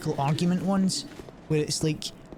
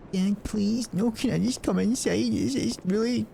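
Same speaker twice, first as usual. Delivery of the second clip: put-on higher pleading kid voice begging to come in; pleading child; 6 semitones higher than usual. Kid begging dad to come inside, high pleading voice. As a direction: play the shivering kid begging Dad at the door. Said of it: "they would say".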